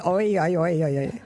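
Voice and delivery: "Oy, oy" repeated, the South Jersey oy vowel, last ones fading into a laugh; mock Jersey oy. Shown as a demonstration. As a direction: chant the Jersey "oy" sound, laughing by the end. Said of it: "they need another I in the middle between the two O's".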